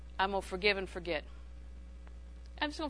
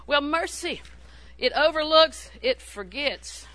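forceful preaching; 5 semitones higher, 6 dB louder, slower than usual. Real, not acted.

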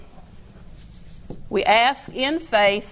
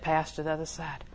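soft low recitation of the word; hushed, steady quoting; quiet repeated scripture; 4 semitones lower, 9 dB quieter than usual. Retold or imitated. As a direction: murmur the verse like a promise. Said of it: "Jesus said".

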